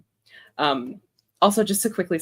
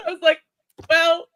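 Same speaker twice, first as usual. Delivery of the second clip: laughing; 11 semitones higher, 5 dB louder than usual. Real, not acted. Laughing retelling.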